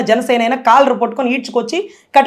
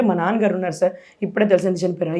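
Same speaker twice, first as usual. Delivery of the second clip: softer, subdued retelling of the news; quiet grief; 6 semitones lower, 6 dB quieter than usual. Retold or imitated. Recalling telling the senior of her father's death, quiet and sad. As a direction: say it quietly, holding back tears.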